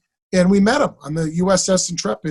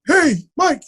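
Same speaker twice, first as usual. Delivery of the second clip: higher-pitched retold greeting; Chazz; 11 semitones higher, 4 dB louder than usual. Acting teacher greeting his student, lightly imitated. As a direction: call out a name in someone else's voice. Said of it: "Chazz says to me".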